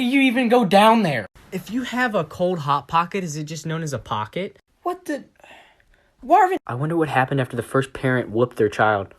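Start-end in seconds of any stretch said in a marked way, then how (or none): 4.8-6.6 high, startled cry of a name; startled yelp. Real, not acted. Caught off guard by Marvin, startled high yelp. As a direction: jump at the sudden sight.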